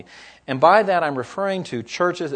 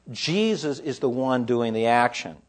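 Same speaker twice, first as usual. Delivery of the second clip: ordinary delivery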